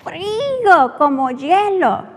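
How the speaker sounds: high, loud, slow complaining rant; loud drunk complaint. Retold or imitated. Drunk customer complains loudly to the owner about cold soup. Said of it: "he starts saying".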